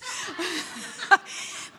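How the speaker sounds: a short, bright laugh at her own confession; light laugh. Real, not acted.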